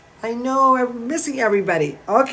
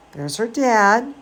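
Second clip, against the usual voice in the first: ordinary delivery, nothing marked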